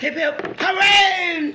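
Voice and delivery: loud high cheer. Real, not acted. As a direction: shout hip hip hooray. Cheering after a win.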